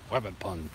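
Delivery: low, soft "Wabbit", R turned to W; childlike W sound starting "Wabbit"; cartoon mispronunciation. Cartoon wordplay on rabbit.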